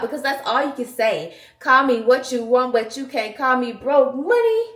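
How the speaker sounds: normal talking voice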